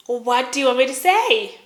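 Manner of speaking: exasperated shout in a retold argument; acted exasperated outburst. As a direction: throw it back loudly, cornered and fed up. Cornered, shouts back at accuser.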